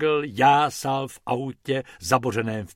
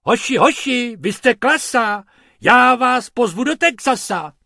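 high, loud delighted cheer; calling out; 10 semitones higher, 8 dB louder than usual. Acted. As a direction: call out to the boys with glee. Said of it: "pan Pumpernagel volal".

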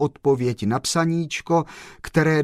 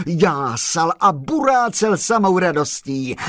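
rising higher and faster as the car races; building excitement; 7 semitones higher than usual. A narrator's reading. Narrator tells listeners how the car sped, building excitement. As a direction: pitch climbs with every speed.

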